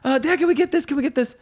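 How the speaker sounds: put-on very high, excited child squeal; mock begging kids. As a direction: pester Dad like overexcited kids. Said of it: "they weren't like".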